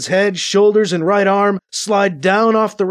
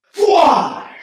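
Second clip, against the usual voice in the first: raised, whooshing energy-gun shot; rifle blast sound; 10 semitones higher, 4 dB louder than usual. A narrator's reading. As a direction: imitate a laser blast with the mouth. Narrator voices rifle blast sound effect.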